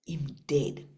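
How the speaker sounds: whispered, breath only; hushed whisper. Acted. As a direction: drop to a whisper for the death. The young man is found dead.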